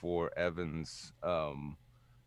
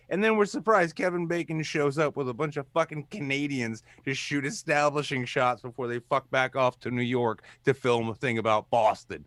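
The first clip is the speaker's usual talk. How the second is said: ordinary talk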